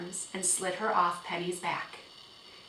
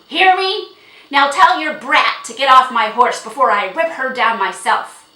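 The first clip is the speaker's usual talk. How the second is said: loud, high, blustering tirade; furious shouting; 6 semitones higher, 16 dB louder than usual. A narrator's reading. Horse owner refusing to sell and threatening, furious shouting. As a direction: roar the refusal and keep the force up. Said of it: "shouted Jiggy".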